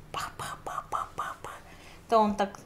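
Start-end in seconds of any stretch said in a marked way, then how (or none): 0.1-1.5 slow whispered pa-pa-pa engine noise; whispered tractor putter. Shown as a demonstration. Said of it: "А как трактор делать?".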